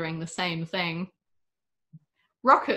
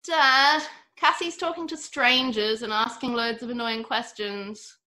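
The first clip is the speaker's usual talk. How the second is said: tattle to Dad with a groan in it; whiny tattling child; 5 semitones higher than usual. A narrator's reading. Wilma tells on her sister to Dad, whiny. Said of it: "Wilma groaned".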